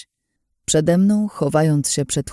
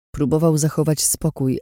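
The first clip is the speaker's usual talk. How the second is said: usual speaking voice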